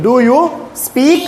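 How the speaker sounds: very slow, drawn-out question; slow modelling. Shown as a demonstration. Teacher modelling an English question for pupils, slowly drawn out. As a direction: stretch each word so learners can follow.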